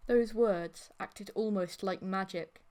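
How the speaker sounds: plain reading voice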